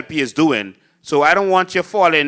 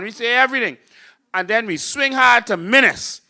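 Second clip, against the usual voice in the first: usual speaking voice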